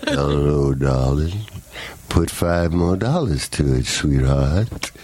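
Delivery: low, sultry crooning voice. Acted. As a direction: purr it like a smooth charmer. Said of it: "It must be your sultry voice".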